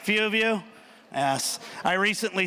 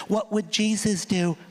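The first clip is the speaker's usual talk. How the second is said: pamphlet voice. Retold or imitated. Reading the pamphlet aloud.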